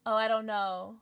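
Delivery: ordinary talk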